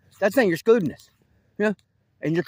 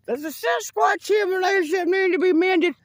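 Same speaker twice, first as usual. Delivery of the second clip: message voice; 11 semitones higher than usual. Retold or imitated. Relaying the Sasquatch message in a high eerie voice. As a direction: voice the message as if from beyond.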